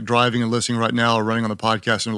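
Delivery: ordinary delivery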